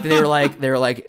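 normal talking voice

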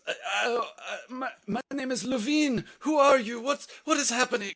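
high, loud, stammering panic; frightened coerced introduction. Acted. Game master as a man with a sword at his throat, panicked. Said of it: "This is a, a coerced hello".

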